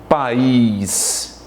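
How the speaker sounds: país slowly in two syllables, pa-ÍS; vowels separated. Shown as a demonstration. Teacher shows pupils the hiatus, país split into pa-ÍS. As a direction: draw out país, parting A from stressed I. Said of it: "há uma separação da letra A da letra I".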